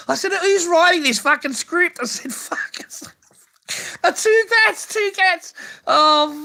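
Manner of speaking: very high, loud disbelieving squeal; incredulous high shriek. Real, not acted. Ranting to the boys about the second cat in a disbelieving falsetto. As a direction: shriek in disbelief at the two cats.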